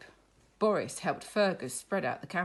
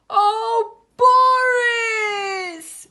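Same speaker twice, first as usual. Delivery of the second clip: high, loud sung-out wail; a little boy lamenting his lost teddy; wailing cry; 15 dB louder, much slower than usual. Acted. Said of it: "he cried".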